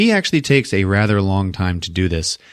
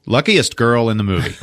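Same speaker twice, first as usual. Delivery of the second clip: ordinary delivery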